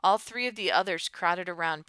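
ordinary delivery, nothing marked

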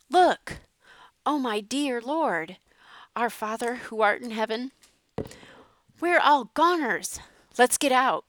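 loud, high frantic cries of terror; panicked exclaiming. A narrator's reading. Father facing the flood, panicked loud cries. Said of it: "Dad exclaimed".

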